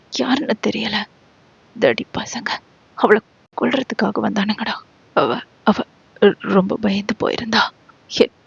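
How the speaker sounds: hushed, shaken whisper, words tumbling out fast; scared friend blurting his fear under his breath; quiet frightened rambling; frightened babbling. Acted. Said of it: "என்று பிதற்றிய".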